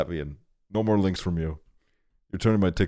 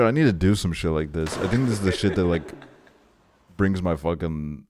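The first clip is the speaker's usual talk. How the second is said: ordinary delivery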